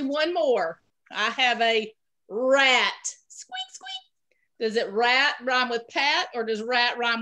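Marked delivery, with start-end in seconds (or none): 3.3-3.9 rat squeak. Pretend. Teacher imitates a rat.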